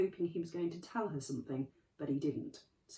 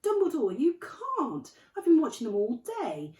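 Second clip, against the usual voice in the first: high, loud outraged protest; indignant protest; 6 semitones higher, 10 dB louder than usual. Acted. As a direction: jump up and object hotly.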